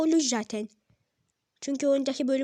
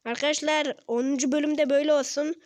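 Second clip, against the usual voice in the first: normal talking voice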